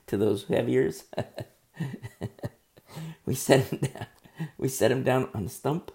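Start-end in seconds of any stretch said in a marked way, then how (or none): 0.1-4.1 real chuckle breaking into the words; laughing. Real, not acted. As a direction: talk through your own amusement. Speaker cracks up at own stump joke.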